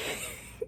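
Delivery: a quick unvoiced giggle; real breathy laugh. Real, not acted. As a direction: laugh under your breath. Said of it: "right now I'm laughing".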